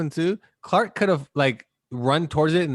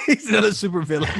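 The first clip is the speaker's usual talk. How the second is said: fast, louder laughing repeat; laughing echo; 5 semitones higher, 4 dB louder, faster than usual. Real, not acted. Echoing his friend's joke about Bill Cosby, laughing. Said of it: "echoing friend's joke".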